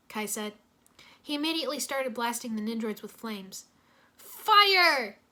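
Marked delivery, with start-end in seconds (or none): 4.2-5.2 loud, high shout; battle cry. Acted. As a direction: yell the attack as you strike. Kai blasts Nindroids with flames.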